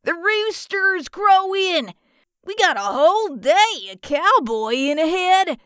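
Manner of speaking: high, eager cowboy twang; hammed-up, drawn-out cowboy accent; cowboy drawl. A narrator's reading. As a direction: holler it like a chirpy cowboy. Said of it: "he said in his best cowboy drawl".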